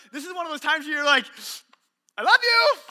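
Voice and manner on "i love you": a high, loud, nervously helpless husband; sheepish husband. Retold or imitated. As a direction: offer it weakly, with nothing else to give.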